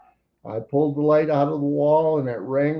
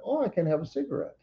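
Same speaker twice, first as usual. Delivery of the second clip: eager inner thought; 7 semitones higher, 6 dB quieter, much faster than usual. Retold or imitated. Excited thought about a cigarette.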